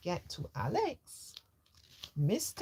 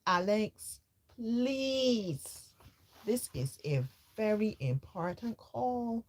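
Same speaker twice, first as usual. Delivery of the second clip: exasperated dad; 6 semitones higher than usual. Acted. Dad scolds son on phone.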